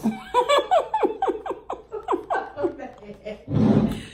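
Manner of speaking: high loud burst of laughter. Real, not acted. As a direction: let a big laugh ring out. Friend laughing at favors.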